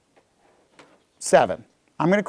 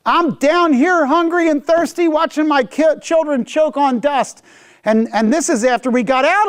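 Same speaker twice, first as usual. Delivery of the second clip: high, raised lament, slowing at the end; anguished complaint; 10 semitones higher than usual. Retold or imitated. Hungry Israelite voicing despair to God, high and anguished. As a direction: cry out from hunger and thirst.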